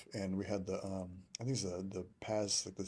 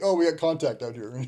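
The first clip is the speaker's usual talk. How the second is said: higher, louder mock alarm through laughter; laughing mock radio call; 6 semitones higher, 10 dB louder than usual. Veteran retelling bored troops faking contact, laughing. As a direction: fake an alarmed report while cracking up. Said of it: "they'd be like".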